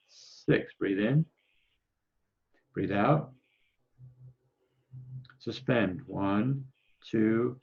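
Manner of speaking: steady counted breathing coach voice; guided breathing cues. Acted. Coach leading online group through breath holds, steady counting. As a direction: pace the group through each breath calmly.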